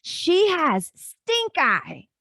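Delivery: put-on slow, high, loud little boy voice; a lightly played excited child; imitating her son. Mom retelling her son's news, in a slow high kid voice. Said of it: "And he said".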